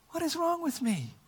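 high, soft mock wail; retold remorseful plea. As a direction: plead with God in a thin, high voice. Remorseful prayer, repeated higher.

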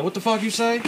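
high-pitched imitated confrontation; mock angry challenge. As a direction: throw the line back in mock outrage. Retold confrontation, sister quoted.

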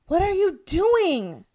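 high, slow alarmed shout; panicked yell. A narrator's reading. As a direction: shout in shock at her. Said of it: "Natalie shouted at me".

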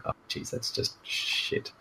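whispered, slow Irish grumble; unvoiced mock grumble. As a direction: mutter it under your breath, slowly. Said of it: "they're like".

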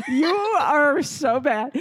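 doctor teasing the new mother through laughter; laughing scold. Retold or imitated. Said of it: "doctor's laughing reply".

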